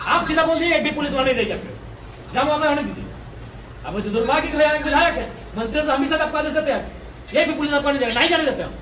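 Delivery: loud, forceful demand; loud protest. Real, not acted. Protest leader to officials about police guarding the company, loud and forceful.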